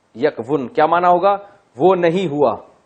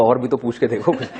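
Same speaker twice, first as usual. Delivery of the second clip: laughing; 3 semitones lower, 5 dB quieter, faster than usual. Retold or imitated. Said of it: "میں نے کہا".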